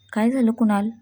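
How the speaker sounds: ordinary delivery, nothing marked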